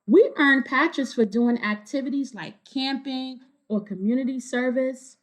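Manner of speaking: clear, lively storybook reading; reading to children. A narrator's reading. Reader telling kids the story, bright storybook narration. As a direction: read it to a room of kids. Reading picture book aloud.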